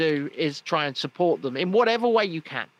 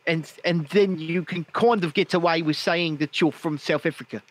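put-on South African with accentuated vowels; South African accent. Shown as a demonstration. Host demonstrating how to pass as local, South African accent. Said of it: "start talking like this".

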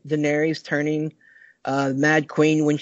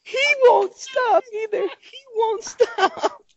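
high, breathless laugh-crying through the words; barely getting the words out for laughing; crying with laughter; slower than usual. Real, not acted. Host laughing at a co-host whose stream keeps glitching, laugh-crying. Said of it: "I cannot stop crying".

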